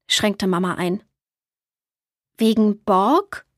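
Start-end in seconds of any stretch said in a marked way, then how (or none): 2.4-3.5 langsam, verstört fragend; kleinlauter Waldjunge. A narrator's reading. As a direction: frag langsam, als dämmere dir die Schuld. Borg fragt kleinlaut. (Borg fragt langsam und verstört nach sich selbst)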